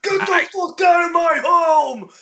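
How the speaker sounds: high, full-force furious shout; crazed yell. Retold or imitated. Homeless man yelling at noisy kids in the mill, crazed shout. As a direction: yell it like a madman guarding his home. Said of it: "like, the craziest fucking yell".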